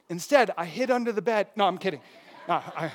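usual speaking voice

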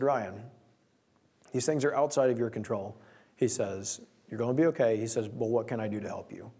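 soft, low reassuring friend; a friend softly offering help. Retold or imitated. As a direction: quietly reassure a stressed friend.

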